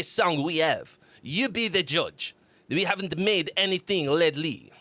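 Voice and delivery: put-on French accent, reading aloud; playful French-accented reading. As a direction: read it out in a mock French accent. Reads band's note in French accent.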